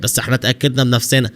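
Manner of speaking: ordinary delivery, nothing marked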